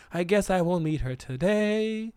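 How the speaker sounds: a hammed-up dejected suitor; mopey guy. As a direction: sigh it out slowly like a lightly mopey suitor. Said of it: "so he's like".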